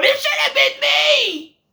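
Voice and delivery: mock tantrum, whining that it should have been him; whiny hissy fit. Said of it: "hissy fit going".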